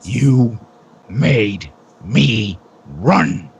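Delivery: slow, word by word; menacing reproach. Acted. Guard to the runaway he has caught, slow menacing reproach. Pursuer punishing the man he caught.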